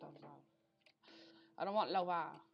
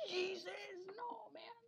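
dismayed; 4 dB quieter, slower than usual. Real, not acted. Falls in the game.

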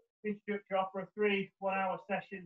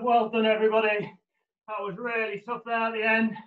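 ordinary talk, nothing marked

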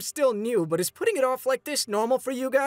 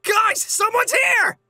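alarmed shout; 11 semitones higher, 7 dB louder than usual. Acted. Alarmed call to the group.

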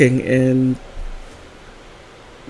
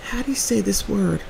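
softer, higher, laughing sister voice; imitating sister; 8 semitones higher, 6 dB quieter, slower than usual. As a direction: ask sheepishly like the sister. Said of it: "she'll say".

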